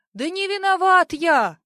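high, loud desperate plea; pleading cry. A narrator's reading. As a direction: beg out loud. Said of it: "взмолился Афоня".

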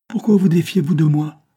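high, loud, imploring question; pleading cry. A narrator's reading. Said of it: "s'écria Gérard". (exclamation suppliante)

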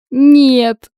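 sobbing. A narrator's reading. A tearful girl answers a worried friend, sobbing. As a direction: blurt it out through tears. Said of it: "прорыдала я".

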